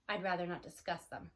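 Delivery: low and soft, holding back memories. A narrator's reading. Old cow to curious Tess, low and soft. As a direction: say it low, closing the subject. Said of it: "said Annabelle in a low voice".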